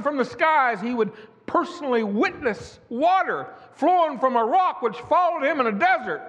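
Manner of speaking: high, ringing pulpit cadence; fiery preacher rolling through the testimony; preaching at full force. Acted. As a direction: preach it out to the congregation, building. Preaching about Moses.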